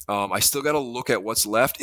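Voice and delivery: normal talking voice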